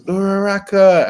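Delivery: loud, high, drawn-out call; Deku calling out. Acted. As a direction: cry out the name, stretching it. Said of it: "and then Deku goes".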